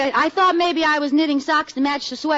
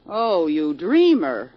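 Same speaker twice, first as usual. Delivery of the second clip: slow teasing; 6 semitones lower, much slower than usual. Acted. Teasing Katie.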